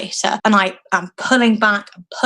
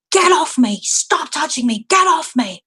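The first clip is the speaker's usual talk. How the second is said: high, urgent cry without full volume; retold scream; 7 semitones higher than usual. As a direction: re-enact screaming at someone to let go. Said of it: "I just screamed at her".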